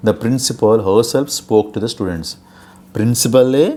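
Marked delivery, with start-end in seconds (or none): 2.9-3.7 ప్రిన్సిపలే loud, higher, rising at the end; raised, emphatic ప్రిన్సిపలే; emphatic stress. Shown as a demonstration. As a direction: hit ప్రిన్సిపలే and lift its last syllable. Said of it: "దీన్ని మనం emphasize చెప్తాం".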